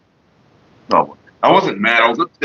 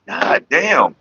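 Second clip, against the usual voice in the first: ordinary talk, nothing marked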